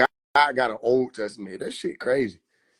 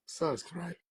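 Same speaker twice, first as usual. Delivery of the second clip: ordinary talk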